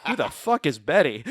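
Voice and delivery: baffled outburst. Retold or imitated. Baffled retort to Hulk's accusation.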